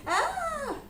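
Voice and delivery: mock high-pitched sustained cry; high held "ah". Husband in scrubs to scared wife, mock high cry. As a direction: hold a very high note, imitating him.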